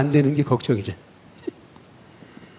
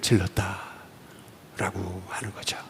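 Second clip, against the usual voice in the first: hushed whisper; 5 semitones lower, 13 dB quieter, slower than usual. Real, not acted. Preacher whispers to the congregation, slow hushed whisper.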